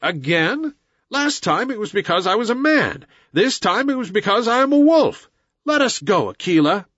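put-on high, rising protest of a young outcast; indignant boy hero. Mowgli protests to Akela at being cast out, indignant. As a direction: climb in pitch as you protest.

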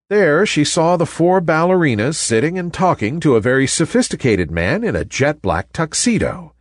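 usual speaking voice

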